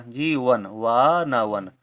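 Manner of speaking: normal talking voice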